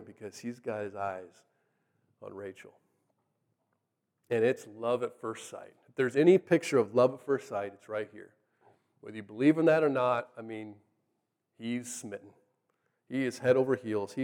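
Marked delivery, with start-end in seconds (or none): none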